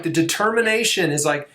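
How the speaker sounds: ordinary talk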